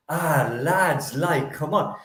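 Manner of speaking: lightly imitated West Cork village accent. Imitating a girl from university, broad West Cork accent. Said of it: "had this brutal West Cork accent".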